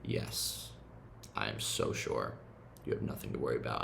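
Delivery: put-on low hushed comfort for the inner child; tender reassurance. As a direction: cradle a frightened child with your voice.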